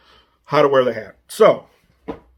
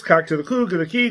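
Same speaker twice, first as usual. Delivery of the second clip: normal talking voice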